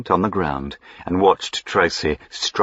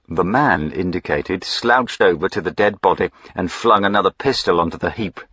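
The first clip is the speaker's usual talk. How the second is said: ordinary delivery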